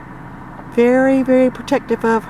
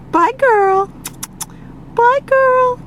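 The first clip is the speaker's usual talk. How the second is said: much higher goodbye to a pet; high sing-song goodbye; 11 semitones higher, slower than usual. Real, not acted. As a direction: call it high and sweet to the bird. Calling goodbye to the eagle.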